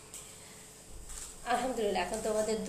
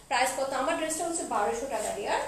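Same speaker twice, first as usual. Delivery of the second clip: ordinary talk, nothing marked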